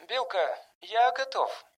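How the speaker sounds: high, soft, distant intercom voice; over the speaker. A narrator's reading. Jimmy reporting ready to Bilka, over intercom. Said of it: "раздалось из динамика".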